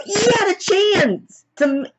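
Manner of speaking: very high, drawn-out wail; mock anguish, squealing in protest; slow, squeaky cry of disbelief; outraged protest. Acts out upset reaction to film.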